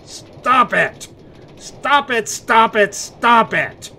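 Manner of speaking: loud, high exasperated yelling; frustrated outburst. Acted. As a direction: blow up at the repeated question, then hammer the stop it lines. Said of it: "Sorry, I'm better now".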